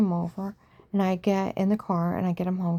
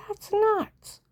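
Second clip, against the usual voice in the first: outraged mom. Retold or imitated. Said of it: "She said".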